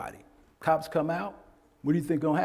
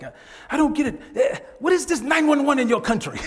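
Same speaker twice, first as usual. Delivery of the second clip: higher, louder voice of a client; quoting an African client; 9 semitones higher, 5 dB louder, faster than usual. Retold or imitated. Instructor quoting an African client, higher and louder. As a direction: voice the client complaining earnestly. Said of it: "he was just like".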